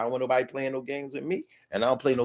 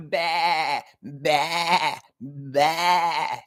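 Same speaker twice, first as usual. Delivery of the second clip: lamb bleat; much slower than usual. Pretend. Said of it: "That was my [name] impression".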